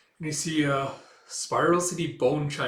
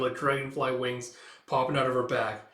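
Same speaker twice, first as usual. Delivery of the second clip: usual speaking voice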